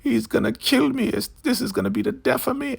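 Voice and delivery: put-on very high, wailing old-woman voice; exaggerated falsetto despair; mock anguish. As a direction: wail it like a terrified old woman, hammed up. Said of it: "She is, like, in anguish".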